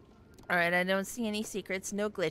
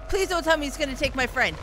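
ordinary talk

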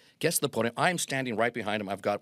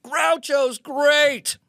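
drawn-out yell, far higher and louder; scream; 8 dB louder, much slower than usual. Retold or imitated. Boy shouting down the stairwell to his hero, long loud yell. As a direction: bellow it down the stairwell. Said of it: "I scream at the top of my lungs".

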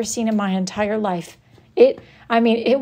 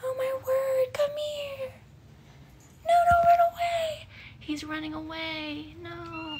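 very high, much softer cooing; soft baby talk; 8 dB quieter than usual. Acted. Owner softly cooing very high as pet runs away. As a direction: coo softly after the running pet.

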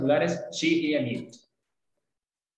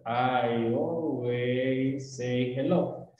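very slow, linked words, lower; fluid, slow; 3 semitones lower, much slower than usual. Shown as a demonstration. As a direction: glide from word to word like cruising on a highway. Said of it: "Tiene que ser como fluido".

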